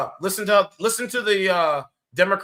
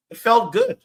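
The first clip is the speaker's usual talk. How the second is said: higher and louder, gleeful; excited; 6 semitones higher, 6 dB louder than usual. Real, not acted. Streamer gloating that the debate felt good, higher and louder. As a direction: relish the win out loud.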